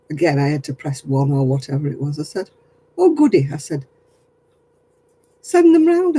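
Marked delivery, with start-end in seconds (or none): 3.0-3.5 mock delight. Sarcastic reply to police threat. 5.4-6.2 mock eager invitation. Sarcastic dare to scammer.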